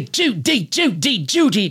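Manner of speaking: a hack Cary Grant; quizzing David with a weak impression; bad Cary Grant. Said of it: "a bad impression".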